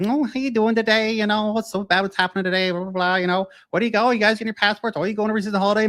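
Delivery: high, sing-song chatty clerk voice; chirpy clerk ladies. Retold or imitated. Courthouse clerk chatting to mom and son, high and breezy. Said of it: "they're like".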